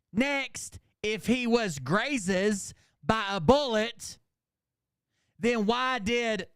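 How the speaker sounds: high, slow, declaimed read-out in separate chunks; mock haiku recital. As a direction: declaim each short phrase slowly with a pause after it. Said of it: "in haiku format".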